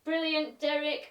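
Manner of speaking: ordinary delivery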